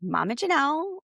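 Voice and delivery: normal talking voice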